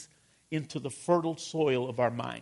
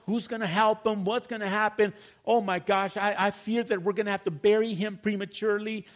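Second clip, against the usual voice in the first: hammed-up fretting, climbing higher as the worries pile up; acted worrying; 4 semitones higher, 4 dB louder than usual. Preacher acts out negative meditation over little Johnny, mounting anxious fretting. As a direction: spiral into mock panic, one worry after another. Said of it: "illustrate meditation in the negative".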